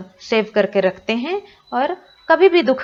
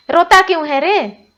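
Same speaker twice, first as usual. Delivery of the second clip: put-on higher, louder old granny asking why he cries; granny comforting; 8 semitones higher, 6 dB louder than usual. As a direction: comfort the grown son as granny. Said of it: "दादी माँ बोली".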